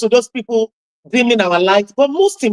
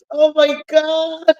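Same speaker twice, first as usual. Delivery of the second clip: amazed; 6 semitones higher, slower than usual. Retold or imitated. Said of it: "And I'm like".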